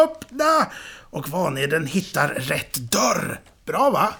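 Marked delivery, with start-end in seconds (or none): 0.0-0.7 high-pitched demanding yell; shouting. A narrator's reading. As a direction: cry out to be let out, pitched high. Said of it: "Sickan skriker".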